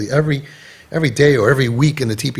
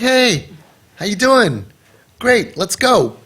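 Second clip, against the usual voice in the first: bright, easygoing hello, pitched up; breezy friendly greeting; 6 semitones higher, 3 dB louder, slower than usual. Shown as a demonstration. Father greeting his late daughter, relaxed and cheerful. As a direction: greet her like nothing's wrong. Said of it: "you should be like".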